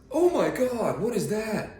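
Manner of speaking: high, loud hammed-up shock; mock shocked gawker. Mocking someone gawking at a missing arm, hammed-up shock. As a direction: gasp it like a rude onlooker. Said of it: "mocking rude gawkers".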